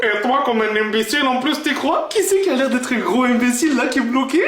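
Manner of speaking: mimicking dad. Son parroting dad in a low mock voice. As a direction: throw dad's own insult back in his voice. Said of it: "son parrots dad's words back".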